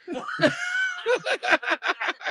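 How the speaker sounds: high shouted laugh; real laughter bursting out. Real, not acted. Laughing at the Nubs punchline.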